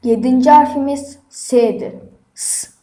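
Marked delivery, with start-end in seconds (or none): 2.3-2.7 S as a pure voiceless hiss; letter sound demo. Shown as a demonstration. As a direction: sound out S as "sss", no vowel. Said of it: "diye okunur". (S'yi "sss" diye tısla)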